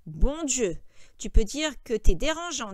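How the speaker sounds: loud, annoyed oath; exasperated complaint. A narrator's reading. George fed up with troublesome Lenny, exasperated.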